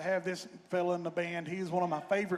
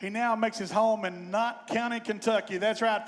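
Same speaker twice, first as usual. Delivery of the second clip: normal talking voice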